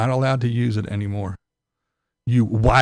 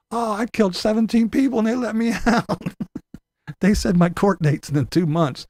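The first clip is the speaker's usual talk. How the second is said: higher, gloating hammed-up criminal voice; mock released criminal; 9 semitones higher than usual. Freed criminal bragging about his court date, mock gloating. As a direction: brag like a freed crook.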